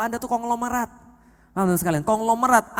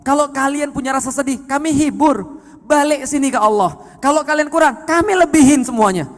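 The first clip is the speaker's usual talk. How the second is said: preaching at full force; 7 semitones higher, 7 dB louder than usual. Acted. Voicing God's call, preaching.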